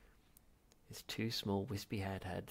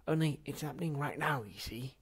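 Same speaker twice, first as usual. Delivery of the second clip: high, loud urgent plea; feverish insisting; 5 semitones higher, 6 dB louder than usual. Acted. Zaphod insisting to ancestor, feverish. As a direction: press him urgently. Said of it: "insisted Zaphod feverishly".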